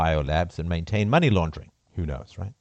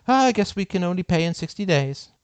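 nonchalant; 10 semitones higher than usual. Retold or imitated. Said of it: "she nonchalantly says".

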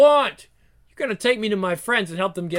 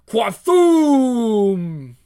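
loud voiced thunder crack; thunder crack sound; 4 semitones higher, 6 dB louder than usual.

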